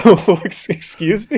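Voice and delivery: high, loud, laughing double take; startled disbelief. Retold or imitated. Host retelling his shock at the proposal, startled. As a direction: blurt it out high, breaking into a laugh. Reacting to a surprise marriage proposal.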